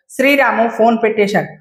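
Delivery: usual speaking voice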